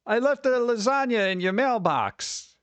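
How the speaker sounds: high, drawn-out mock mom call; hammed-up Italian mom hollering from the car. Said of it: "an Italian mom who's worried about you".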